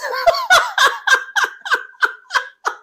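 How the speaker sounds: real laughter breaking out; helpless high laughter; high squealing laugh. Real, not acted. Cracking up at the memory.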